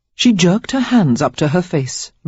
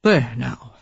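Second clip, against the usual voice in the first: soothing there now; 4 semitones lower than usual. A narrator's reading. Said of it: "as Lily Rayford say to crying children".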